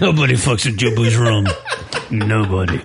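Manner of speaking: put-on low, heavy Cuban-accented movie quote; Pedro Serrano impression. Quoting Major League to his cohost in a low mock Cuban accent. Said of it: "Why does it sound like Pedro Serrano?".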